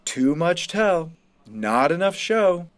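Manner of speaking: slow, even teacher's verdict; simply, plainly stated. Acted. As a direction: lay it out flatly, like a final word. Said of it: "Finchen says simply".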